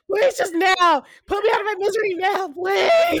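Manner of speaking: high, loud, fast mock wailing plea; desperate begging. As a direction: wail for mercy in mock agony. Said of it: "Imagine being around people like".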